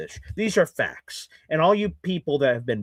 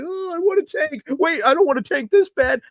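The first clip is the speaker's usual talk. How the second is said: high-pitched mock fan wail; mocking, high-pitched imitation of a flip-flopper; 11 semitones higher than usual. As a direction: mimic fickle fans in a much higher voice. Mimics wavering fans who want losses.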